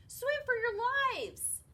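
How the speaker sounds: very high, loud, slow alarm; panicked shout. Acted. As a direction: scream a warning to everyone. Joey panics at squid.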